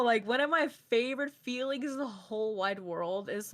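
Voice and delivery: ordinary delivery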